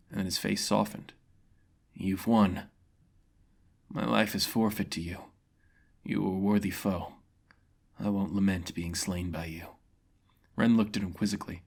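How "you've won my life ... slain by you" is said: a beaten man accepting death; solemn swordsman conceding defeat; defeated mercenary. Acted.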